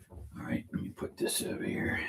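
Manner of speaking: whispered aside to himself; soft whisper while working. Real, not acted. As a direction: breathe it out without voice. Whispering while doing dishes.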